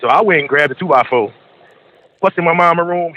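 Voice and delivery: ordinary talk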